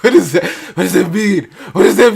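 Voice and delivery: loud high panicked questions; alarmed questions. Retold or imitated. Scared kid hearing a knock, alarmed questions. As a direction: blurt it out in alarm.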